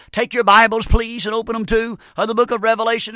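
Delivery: mock arrogant pulpit announcement; arrogant young preacher. Young man opening his first big-meeting sermon, mock arrogant. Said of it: "this young man goes up arrogantly".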